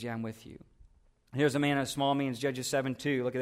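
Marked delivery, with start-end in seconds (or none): 0.0-0.6 low and much softer, a calm yes; quiet reassuring answer; gentle God voice. Acted. God quietly reassures Gideon on the front line, low and soft.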